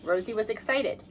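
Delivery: ordinary delivery, nothing marked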